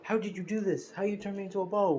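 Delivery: high, mock-panicked villain screaming; retold scream. Sludge Villain screaming at Deku, high mock panic. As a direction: squeal it out like a blob in pain. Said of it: "he's pretty much screaming".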